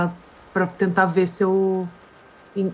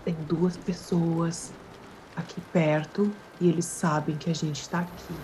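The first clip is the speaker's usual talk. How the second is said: speaking very low; 2 semitones lower, 9 dB quieter than usual. Acted. Pérsia quietly warns Ori of two people nearby, hushed. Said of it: "Falando baixinho, né?".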